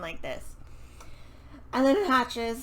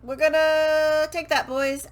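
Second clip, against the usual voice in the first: loud, higher rallying cry to the crew; rallying call; 4 semitones higher, 6 dB louder than usual. Retold or imitated. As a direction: call out to the boys like a captain. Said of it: "and he goes".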